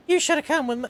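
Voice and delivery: high, loud Southern-belle voice; southern girl accent. Acted. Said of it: "gonna do a southern girl accent".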